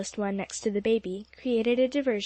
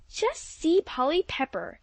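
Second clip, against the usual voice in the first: exclaiming; 5 semitones higher, slower than usual. A narrator's reading. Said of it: "exclaimed Alexia".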